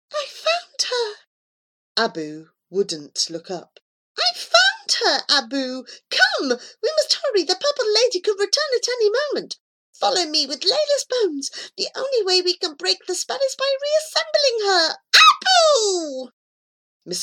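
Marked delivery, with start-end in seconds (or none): 0.1-1.1 high, bright announcement; excited cat voice. Acted. Cat telling Abu she found Layla, high voice. 4.2-16.3 high, loud and hurried pleading; urgent high cat voice. Acted. Cat urging blind Abu to hurry, high and loud.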